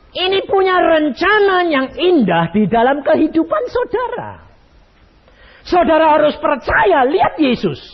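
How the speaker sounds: high, loud preaching on the edge of tears; fervent preaching. Acted. Pastor pleading with congregation, tearful high preaching. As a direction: preach it like your heart breaks.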